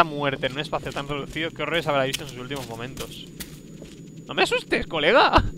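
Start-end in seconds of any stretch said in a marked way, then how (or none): 4.3-5.4 scared protest. Real, not acted. Frightened protest at companion.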